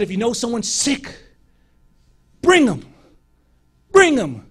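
loud, high preacher's call; urgent sermon call to bring the sick. Retold or imitated. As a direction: shout it to the crowd. Calling out in the sermon.

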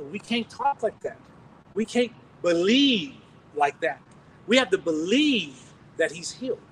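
slow, deliberate correction, each word weighed; firm slow rebuke. Real, not acted. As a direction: set him straight, calmly and firmly. Rebuking doubt, stressing faith.

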